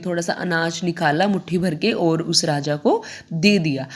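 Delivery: ordinary delivery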